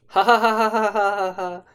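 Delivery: loud, high, mechanical ha-ha-ha; put-on polite laughter at an old joke; fake laugh. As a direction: force out a mock laugh on repeated ha's. Imitates a forced laugh at jokes.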